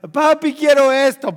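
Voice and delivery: put-on loud, high childish demand; spoiled child. Spoiled child demanding something from papi, loud and high. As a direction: shout it like a pampered kid. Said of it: "No seas de esos niños mimados".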